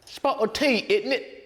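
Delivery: high, hammed-up British caricature; comic Cockney tea-time accent. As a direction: put on a squeaky-high mock British voice. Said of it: "pronounce it with a British accent".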